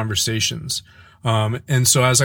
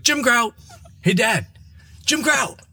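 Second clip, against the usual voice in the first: put-on kids' catchphrase; 13 semitones higher than usual. Kids yelling catchphrase at dad.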